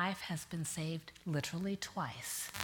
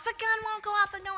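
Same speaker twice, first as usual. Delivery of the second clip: panicked husband; 13 semitones higher than usual. Retold or imitated. Husband panics, gun fails.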